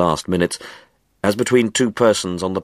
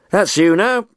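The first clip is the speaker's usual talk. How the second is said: much higher, louder, delighted call; gleeful cry; 12 semitones higher, 4 dB louder than usual. Acted. Window cleaner to Jenkinson as the basin empties, gleeful. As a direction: cry out in triumph, job finished. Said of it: "cried the window cleaner gleefully".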